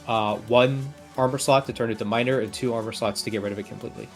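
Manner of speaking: ordinary talk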